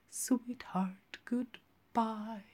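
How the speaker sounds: soft unvoiced farewell; whispered sweetheart goodbye. A narrator's reading. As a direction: breathe a tender goodbye.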